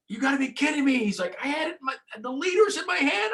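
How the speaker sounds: very high, incredulous cry; can't believe it slipped away; disbelief. Retold or imitated. Mate crying out after losing the marlin, disbelieving. Mate's dismay after the gaff snaps.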